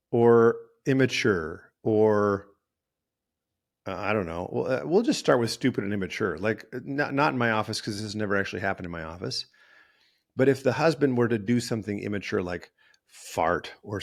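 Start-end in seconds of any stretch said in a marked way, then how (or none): none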